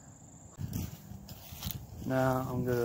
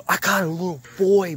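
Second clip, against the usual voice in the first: excited exclaim; 7 semitones higher, 9 dB louder than usual. Real, not acted. Excited at the spirit box.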